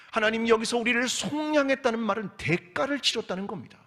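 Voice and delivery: high, loud pleading to God; impassioned plea. Acted. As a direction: preach the plea at full force, voice lifting. Preacher pleads as the people. (하나님께 외치듯 높고 힘찬 탄원)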